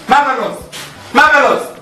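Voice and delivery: sarcastic. Acted. Mocking the sleeping director.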